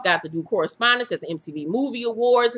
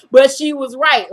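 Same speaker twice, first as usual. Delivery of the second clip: said louder, through leftover laughter; laughing; 4 semitones higher, 7 dB louder than usual. Real, not acted. Admitting her friend was right, still laughing. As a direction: concede it while still laughing.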